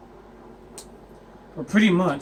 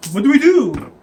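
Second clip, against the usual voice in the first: higher, louder mock dismay; mock helpless party; 6 semitones higher, 8 dB louder than usual. Adventurers facing zombies ask each other, mock dismay. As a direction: play at helpless alarm. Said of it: "And they're like".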